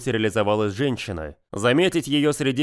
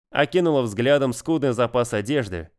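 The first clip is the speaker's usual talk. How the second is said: ordinary delivery, nothing marked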